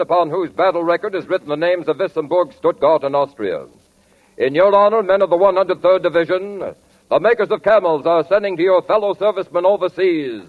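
usual speaking voice

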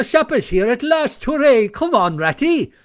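high, loud, gleeful hooray; excited cheer. A narrator's reading. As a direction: call out in sudden delight. Toad cheers supper's arrival.

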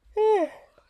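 long weary exhale; loud tired sigh. Real, not acted. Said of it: "Sorry, y'all, I'm tired".

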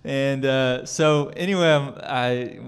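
laughing through the words, high and loud. Real, not acted. Preacher laughing at the doctor saying he was fine, laughing. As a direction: giggle out the embarrassment.

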